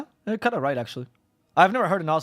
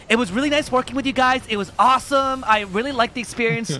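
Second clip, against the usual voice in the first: put-on higher, louder, gushing American accent; 8 semitones higher, 4 dB louder than usual. Caster thanking the orgs, in a gushing American accent. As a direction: mimic an overly nice American caster in an American accent. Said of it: "Was that your American accent".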